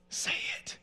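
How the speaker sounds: forceful whisper from an inner voice; whispered urging. Retold or imitated. Inner voice urges the son to speak up, whispered. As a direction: whisper the command insistently.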